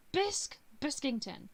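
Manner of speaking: loud, high shout on the first name; calling out. Acted. Visitor banging on the heroine's door, calling out her name. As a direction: call through a door for someone.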